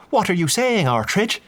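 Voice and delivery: agitated priest questions Artridge; agitated demand. A narrator's reading.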